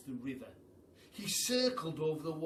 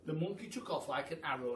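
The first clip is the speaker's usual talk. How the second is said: usual speaking voice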